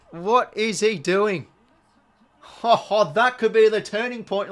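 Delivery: loud, high, breathless match excitement; can't contain it, near-shouting every call; worked up. Real, not acted. Commentator watching the game gets carried away, loud and excited. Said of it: "I need to calm down".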